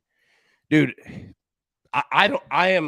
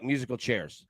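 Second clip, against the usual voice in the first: ordinary delivery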